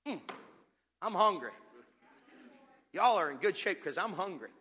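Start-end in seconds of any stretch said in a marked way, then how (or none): none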